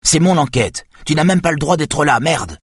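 ton haut et fort, en colère; furieuse. A narrator's reading. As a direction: reprocher avec rage. Saga furieuse contre Yona. (Saga Bauer défend son enquête contre Yona, furieuse)

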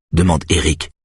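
ordinary delivery, nothing marked

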